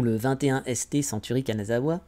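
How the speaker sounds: fully French accent on the English name; French reading. Shown as a demonstration. Vlogger translating the museum's name jokingly, read in French pronunciation. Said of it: "en français".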